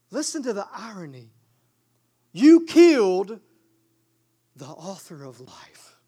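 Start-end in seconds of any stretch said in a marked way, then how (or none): 2.3-3.4 accusing. Acted. Accusing the crowd. 4.5-6.0 whispered, low and hushed. Acted. Preacher telling the crowd who they killed, hushed whisper. Awed contrast after accusation.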